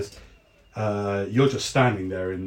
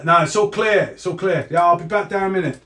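put-on higher, louder call in a plain accent; normal accent, calling out; 6 semitones higher, 7 dB louder than usual. Guard calling out the window to his friend, plain accent, loud. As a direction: shout the all-clear to his friend, no West Country accent. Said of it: "He's just normal".

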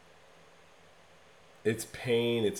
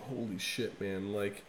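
much softer, hushed and amazed; hushed awe; 4 semitones lower, 10 dB quieter than usual. Retold or imitated. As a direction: breathe it out, stunned by the song. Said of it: "It makes your fucking body just like".

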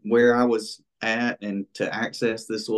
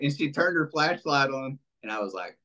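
usual speaking voice

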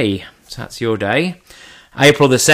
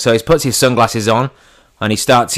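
normal talking voice

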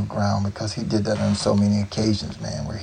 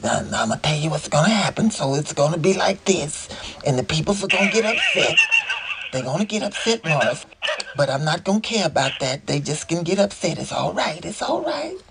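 higher, playful [name] impression; [name]'s funny voice; 6 semitones higher than usual. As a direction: do [name]'s tricky, teasing voice. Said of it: "every time you do that voice".

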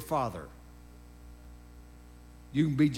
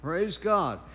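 ordinary delivery

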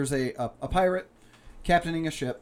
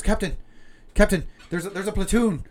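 high, loud, rushing alarm; panicked first mate; 8 semitones higher, 8 dB louder than usual. Acted. First mate warns captain of a platoon of ships in mock panic.

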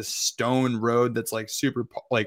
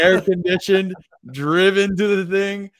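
louder, higher, delighted repeat; excited emphasis; 7 semitones higher, 6 dB louder than usual. Real, not acted. Echoing his friend about the cool BMW ride, excited. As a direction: relish the words like a treat.

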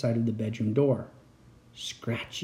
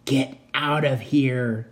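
slow, raspy urgent whisper; hoarse whisper; much slower than usual. Acted. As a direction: rasp the warning slowly. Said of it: "he cried in a hoarse whisper".

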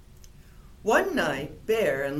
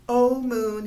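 a louder call up to the moon; 5 semitones higher, 6 dB louder than usual. A narrator's reading. Bear calls up to the moon to dance. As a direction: call longingly to the sky. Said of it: "he called to the sky".

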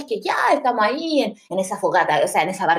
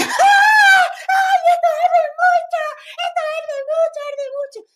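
pained cry; 4 dB louder than usual. Retold or imitated. Mom crying out as the tear gas burns her eyes, a high pained wail. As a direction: shriek like the gas is stinging.

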